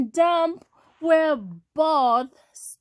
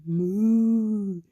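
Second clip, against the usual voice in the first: long, low moo; cow moo; 5 semitones lower than usual. A narrator's reading. Cow says hello to Blue, low moo. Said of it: "Cow said".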